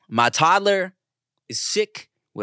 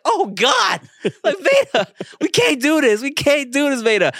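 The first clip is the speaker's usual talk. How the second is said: higher and louder panicked pleading; desperate plea; 11 semitones higher, 5 dB louder than usual. Retold or imitated. Father pleading with his crying toddler, high and loud. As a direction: beg the baby with a half-laugh. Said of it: "I'm like".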